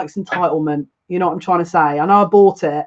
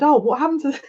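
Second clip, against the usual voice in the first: mock surprise; 8 semitones higher, faster than usual. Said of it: "and I said".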